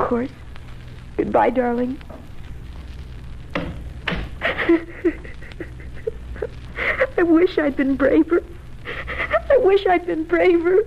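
high tearful farewell. Acted. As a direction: cry through the goodbye. Wife weeps after parting.

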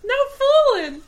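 put-on high pleading boy; whiny kid voice. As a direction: whine it like a kid. Imitating boy's voice.

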